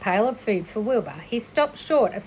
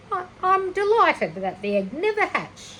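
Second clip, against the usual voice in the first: gabbling; 10 semitones higher than usual. Acted. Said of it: "she gabbled".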